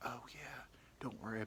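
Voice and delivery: hushed whisper; whispered come-on. Retold or imitated. Woman whispering her offer, hushed. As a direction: whisper it conspiratorially.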